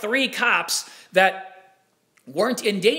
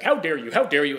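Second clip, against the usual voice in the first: mock British accent; 5 semitones lower than usual. Talk-show host rebuking his guest, mock British accent. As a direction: lower British accent, indignant. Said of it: "in a stupid British accent".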